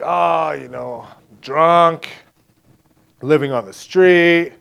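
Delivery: slow, drawn-out drinker's reply; imitated street drinker. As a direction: lightly imitate a drunk shrugging off his day. Retold homeless drinker describing his day.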